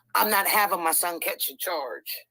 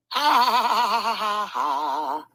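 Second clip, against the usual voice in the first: gloating laugh; 3 semitones higher, 4 dB louder than usual. Real, not acted. Gloating at the ex in court, loud mocking laugh. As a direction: laugh in his face, triumphant.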